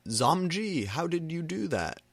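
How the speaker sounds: high, breathless and thrilled; turned-on voice. A narrator's reading. Voldemort amazed at the narrator, turned-on high voice. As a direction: gush at her, amazed and aroused. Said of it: "asked in a turned-on voice".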